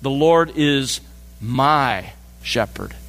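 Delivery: drawn out, intimate weight on my in The Lord is my shepherd; personal stress on my. Shown as a demonstration. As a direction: lean into my, slow and low. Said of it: "David dares to make it much more personal".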